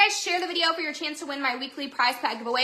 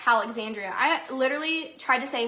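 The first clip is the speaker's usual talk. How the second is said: ordinary talk, nothing marked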